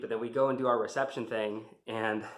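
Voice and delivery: normal talking voice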